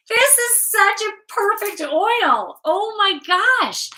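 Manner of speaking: high, loud, delighted gush; excited. Real, not acted. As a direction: burst out in real delight at the perfect card. Thrilled by the card's meaning.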